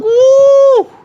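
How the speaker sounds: high shouted whoop; frat bro. Real, not acted. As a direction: whoop in triumph. Celebrating finished chug.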